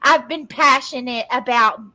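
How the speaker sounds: mimicking someone with a plugged nose; nasal mocking voice. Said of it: "She fucking plugs her nose".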